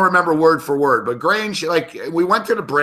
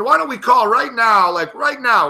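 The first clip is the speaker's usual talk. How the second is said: high, insistent retold challenge; pissed-off challenge; 8 semitones higher than usual. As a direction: push the dare, mildly worked up. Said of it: "I finally got pissed off".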